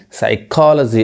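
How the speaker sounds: साइकोलॉजी said as "sai-KOL-uh-ji"; correct pronunciation. Shown as a demonstration. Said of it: "ऐसे पढ़ाता है".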